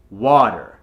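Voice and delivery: water with "ah" and a clear "er" at the end; American accent. Shown as a demonstration. Coach reminds learners of the ending, American accent. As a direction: say "water" American, finishing on a strong r. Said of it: "get that E R sound at the end".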